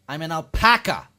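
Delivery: mock outrage, bite their head off; snapping indignantly. Alpaca correcting a stranger, loud mock-indignant snap. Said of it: "they seem to bite your head off".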